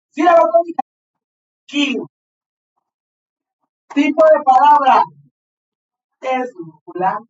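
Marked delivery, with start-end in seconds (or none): none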